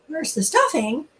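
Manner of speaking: usual speaking voice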